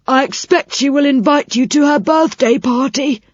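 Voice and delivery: put-on a delighted old fellow near tears; old saucepan man moved by a gift; touched old saucepan man.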